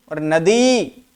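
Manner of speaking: नदी with a long, drawn-out final ई; stretched long vowel. Shown as a demonstration. Teacher contrasting long and short vowels, नदी with its final ई held long. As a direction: lean on and stretch the ई ending of नदी. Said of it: "कौन सा ई में हम भारपूर्वक बोले".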